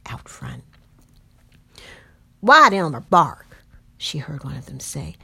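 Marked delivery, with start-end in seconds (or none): 2.4-3.6 a loud, slow, suspicious slave patroller; a coarse night rider asking about the dog; rough patroller voice. Acted.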